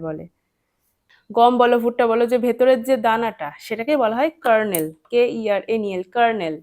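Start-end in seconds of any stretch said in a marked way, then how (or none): none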